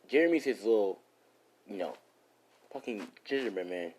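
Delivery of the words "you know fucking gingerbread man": usual speaking voice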